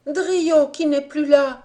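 loud, high and slow, distraught; a frightened girl crying out in alarm; panicked shout. A narrator's reading. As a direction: shout the news while running. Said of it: "Elle courait en criant ainsi".

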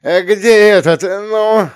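loud impatient high call; impatient counselor. Acted. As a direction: demand an answer impatiently. Counselor impatiently looks for boy.

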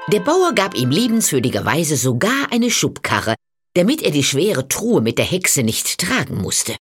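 plain reading voice

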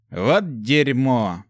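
long, slow, drawn-out oath; stunned drawn-out swearing; exhaled curse. A narrator's reading. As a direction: let the curse out slowly, stretching it. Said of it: "выдохнул он".

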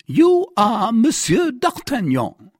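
high, loud, slow pronouncement; insistent declaration. Acted. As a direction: hammer out the accusation. Commissary insists angrily.

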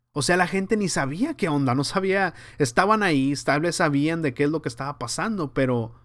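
ordinary delivery

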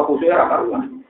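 ordinary talk, nothing marked

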